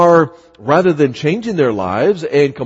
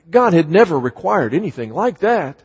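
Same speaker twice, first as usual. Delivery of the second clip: normal talking voice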